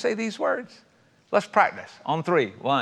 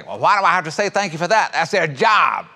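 indignant objection; 2 semitones higher, 5 dB louder than usual. Retold or imitated. People objecting to saying thanks, mock indignant. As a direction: imitate a put-out objector, rising to a loud finish.

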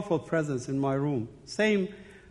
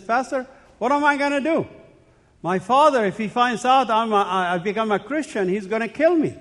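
higher, louder, fast anxious pleading; frightened plea; 5 semitones higher, 4 dB louder than usual. Retold or imitated. A son asking his pastor what to do, frightened plea. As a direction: rush it out like a scared young man begging for help.

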